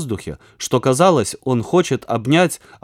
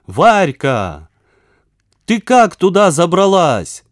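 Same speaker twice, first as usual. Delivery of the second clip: loud, astonished shout; yelling full force; 6 semitones higher, 6 dB louder, slower than usual. A narrator's reading. Веня calls to Варя trapped behind the door, yelling loudly. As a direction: holler through a closed door, pitch climbing. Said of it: "Тут и Веня заорал, что было мочи".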